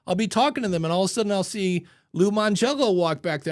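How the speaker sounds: normal talking voice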